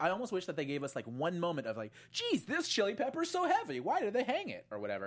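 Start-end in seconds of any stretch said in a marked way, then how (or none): 2.1-4.7 high, squeaky mock gripe; mock whiny complaint. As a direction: squeal it like a cartoon character straining.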